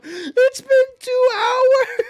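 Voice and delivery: high, loud, slow laughing shriek; hysterical laughing. Real, not acted. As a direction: crack up until it squeaks.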